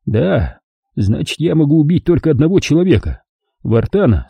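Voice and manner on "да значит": plain reading voice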